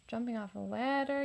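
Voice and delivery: usual speaking voice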